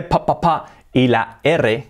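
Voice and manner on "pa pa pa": "pa, pa, pa" with punched P, raised pitch; strong P. Shown as a demonstration. Teacher isolating the strong consonant, plosive P. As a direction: pop each P in "pa, pa, pa". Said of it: "Tenemos la P fuerte".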